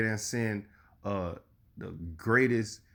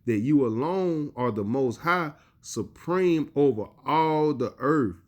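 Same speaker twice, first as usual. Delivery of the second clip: slow, raised solemn reading; scripture reading; 3 semitones higher, slower than usual. Acted. Preacher proclaiming the psalm to his listeners, slow and solemn. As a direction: proclaim the verse slowly.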